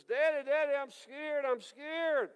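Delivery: put-on high, pleading child's cry; a small boy begging his daddy; scared little boy. Little boy runs to daddy in the storm, high and pleading.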